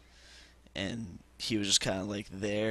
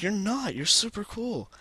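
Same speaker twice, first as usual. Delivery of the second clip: high-pitched imitation of a girl; girl's reassurance; 11 semitones higher than usual. Girl friend protesting his self-put-down, high mock-girl voice. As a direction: put on a much higher voice for the girl.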